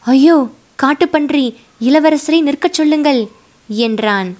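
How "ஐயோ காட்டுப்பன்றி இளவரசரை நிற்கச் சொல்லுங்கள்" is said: higher, alarmed warning cry; frightened, pitched-up plea to stop the prince. Acted. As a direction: panic as the boar appears. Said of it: "முகத்தில் பீதியின் அறிகுறி".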